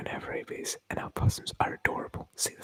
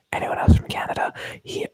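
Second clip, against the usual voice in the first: mock man in the wall; 8 dB louder than usual. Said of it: "the man on the wall would be like".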